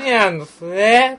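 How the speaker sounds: slow, mock Kyoto "すえ" ending; mock Kyoto accent. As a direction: drag out a fake Kyoto sentence ending. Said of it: "それも変えなあかんの".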